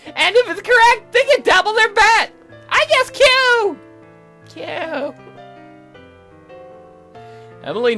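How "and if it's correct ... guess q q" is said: put-on very high, loud squeaky girl; excitable game girl. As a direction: pitch it way up and push it out. Voices Aseline's game idea.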